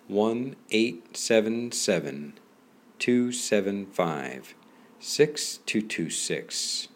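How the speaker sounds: slow, each digit spaced out; slow dictation. Acted. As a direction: read the number so it can be written. Reading out a phone number.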